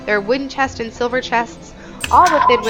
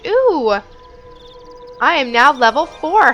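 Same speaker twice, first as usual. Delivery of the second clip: excited; 3 semitones higher, 6 dB louder than usual. Real, not acted. Levelling up in game.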